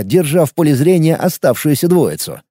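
usual speaking voice

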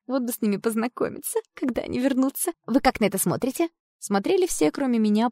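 ordinary delivery, nothing marked

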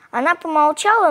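ordinary delivery, nothing marked

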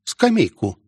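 put-on high-pitched, astonished girl; rising whoas into an amazed question; surprised. A narrator's reading. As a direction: voice a girl taken aback. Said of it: "удивлённо произнесла Мелвин".

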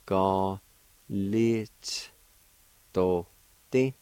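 Ga, lit, do, ti said as four separate beats with pauses between; syllable by syllable. Shown as a demonstration. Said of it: "First part's pronounced like this".

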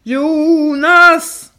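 high, loud yell of a name; skallrande skri. A narrator's reading. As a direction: shout it so it rings through the house. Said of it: "ett skri skallra genom huset". (skallrande skrik, högt och starkt)

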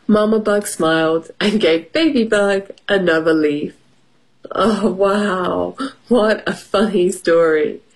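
ordinary delivery

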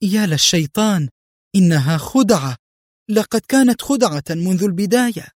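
high cry of sudden realisation; exclaiming. A narrator's reading. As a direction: exclaim as the trick dawns on you. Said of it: "قبل أن تهتف".

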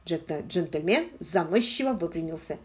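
ordinary delivery, nothing marked

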